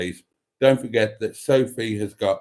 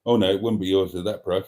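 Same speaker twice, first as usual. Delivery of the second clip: usual speaking voice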